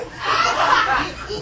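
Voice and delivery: high breathy mocking laugh; jeering laugh. A classmate laughs at the kid being mocked, high and breathy. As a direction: laugh at the target's expense.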